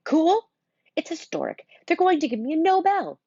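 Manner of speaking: high excited near-shout. Acted. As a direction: swell up with pride. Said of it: "His voice gets louder".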